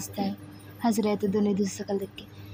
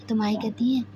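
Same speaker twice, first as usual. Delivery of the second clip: ordinary delivery, nothing marked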